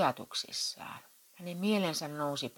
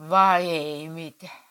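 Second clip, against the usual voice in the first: slow, much louder furious retort; raging; 3 semitones higher, 9 dB louder, slower than usual. Acted. Husband rages at wife's evasive answer. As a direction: spit each word out in anger. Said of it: "raivosi Matti".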